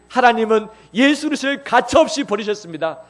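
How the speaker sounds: 가차없이 버리셨습니다 proclaimed loud and high; forceful proclamation. Acted. Preacher declaring the abandonment, loud and forceful. Repeats phrase, loud then soft.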